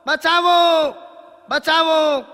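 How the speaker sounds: high, loud desperate shouts; cries for help. Acted. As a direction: yell for rescue as if drowning. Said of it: "સેનાપતિ હવે બૂમો પાડે છે".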